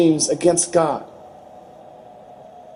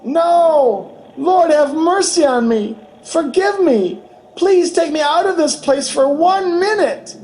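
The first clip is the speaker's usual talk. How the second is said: high, desperate pleading cries; high voiced scream of a woman in torment; retold anguished scream; 10 semitones higher than usual. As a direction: shriek it out like a tormented soul begging for mercy. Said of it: "And she would scream".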